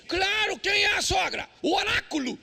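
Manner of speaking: revealing the answer to the church with a shout; preaching shout. Acted. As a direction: call it out from the pulpit. Preacher building to punchline.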